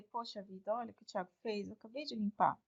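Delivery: a quiet sigh of letdown; muttered to herself. Retold or imitated. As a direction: grumble quietly under your breath. Quoting her own soft remark.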